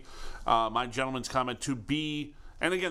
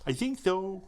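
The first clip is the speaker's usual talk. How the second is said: high put-on character voice; goofy movie-character impression; reply in a silly higher voice; Fletch Lives voice; 7 semitones higher, slower than usual. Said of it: "that was a Fletch Lives voice".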